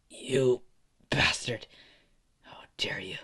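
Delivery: breathy, halting near-whisper that sinks away; weak defiance. Acted. Beaten Izuku to Endeavor after coughing, weakly defiant whisper. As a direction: force it out faintly after choking.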